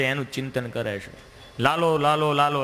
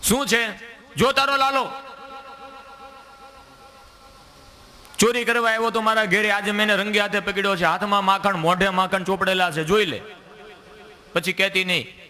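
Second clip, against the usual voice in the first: indignant complaint; 7 semitones higher than usual. Acted. Gopi Prabhavati accuses Kano before Yashoda, high and indignant. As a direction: complain hotly, catching him red-handed.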